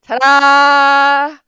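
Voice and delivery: loud, high, drawn-out "ta-da"; triumphant flourish. Real, not acted. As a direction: belt out a long showy reveal. Triumphant flourish after being proved right.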